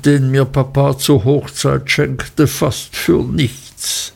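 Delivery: ordinary delivery, nothing marked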